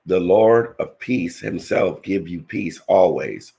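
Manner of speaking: ordinary talk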